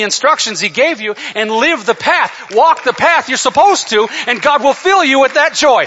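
loud, high, driving sermon climax; full-force preaching. Real, not acted. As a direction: drive the exhortation home to the congregation.

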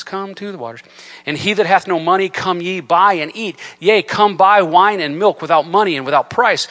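preacher reading Isaiah at full voice; fervent scripture reading. Acted. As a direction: proclaim the verses to the church. Reads Isaiah with rising force.

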